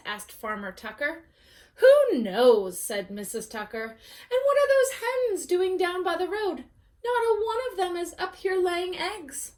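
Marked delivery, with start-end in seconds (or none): 1.8-2.8 much louder shrugging farm wife; Missus Tucker shrugging. Acted. Farm wife answers her husband, loud shrug. 3.8-9.5 higher fussy farm wife; complaining about the hens; Missus Tucker fussing. Acted. Farm wife frets to husband, higher and fussy.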